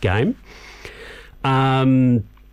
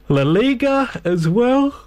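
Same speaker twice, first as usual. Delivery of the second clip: words stretched and pitched up by a yawn; 11 semitones higher, slower than usual. Real, not acted. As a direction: talk through a big yawn. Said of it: "I do apologise for my yawning".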